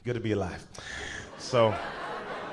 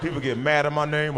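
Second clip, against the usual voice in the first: ordinary delivery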